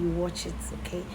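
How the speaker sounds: ordinary talk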